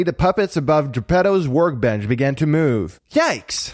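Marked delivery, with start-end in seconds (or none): 3.1-3.7 high-pitched startled yelp of a word; startled cry. A narrator's reading. Pinocchio seeing the puppets move, startled. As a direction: blurt it high, taken aback. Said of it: "cried Pinocchio, startled".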